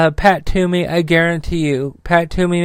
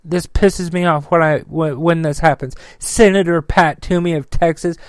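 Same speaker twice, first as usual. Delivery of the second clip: ordinary delivery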